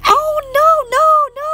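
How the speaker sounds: high loud protesting no; eager denial. Real, not acted.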